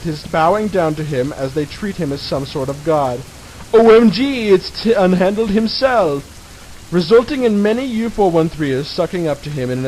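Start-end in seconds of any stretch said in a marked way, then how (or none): 3.7-6.2 excited fangirling. Retold or imitated. Fans greeting their idol.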